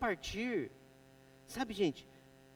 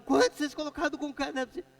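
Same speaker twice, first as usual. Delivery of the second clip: fast, higher, louder yell from up top; retold yelling; 8 semitones higher, 6 dB louder, much faster than usual. Boss yelling from above at the crew, desperate shout. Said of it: "eu gritava".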